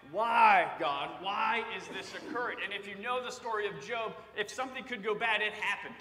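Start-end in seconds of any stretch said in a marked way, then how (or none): 0.1-2.6 a loud pleading cry of why to God. Retold or imitated. Job crying out, voiced.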